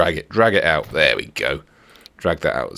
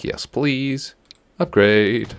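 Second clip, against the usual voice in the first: gleeful; 8 semitones higher, much slower than usual. Pretend. Gleeful at upgrade.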